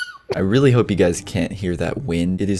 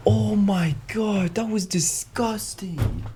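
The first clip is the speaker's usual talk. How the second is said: slow, high, disgusted whisper; 10 semitones higher, 5 dB quieter, much slower than usual. Real, not acted. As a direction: drag it out in disgust. Disgusted, soaked by sneeze.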